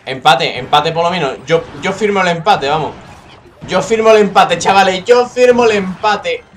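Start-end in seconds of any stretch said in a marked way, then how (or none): 1.5-6.4 raised, emphatic declaration, stretched at the end; shouted acceptance of a draw, higher at the end; loud insistence. Real, not acted. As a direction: proclaim it loudly to the viewers. Pleading for a draw in game.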